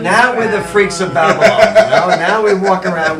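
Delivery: loud laughing brag. Real, not acted. Laughing to the hosts about the Babylon freaks. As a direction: laugh it out, proud of it.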